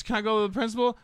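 put-on high-pitched kid voice with a laugh; imitating the kid. Teacher mimics student explaining his nickname, high kid voice. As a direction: squeak it up like a carefree student.

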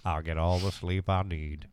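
movie quote. Retold or imitated. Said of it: "Morgan Freeman and Shawshank Redemption".

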